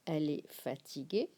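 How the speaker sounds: normal talking voice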